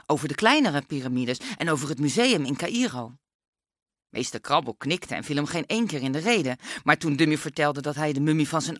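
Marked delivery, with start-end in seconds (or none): none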